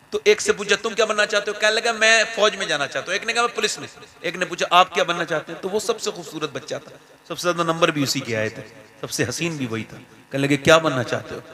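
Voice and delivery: hushed, gentle sermon story voice; soft storytelling. A narrator's reading. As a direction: draw them in softly. (धीमी आवाज़ में कहानी सुनाना)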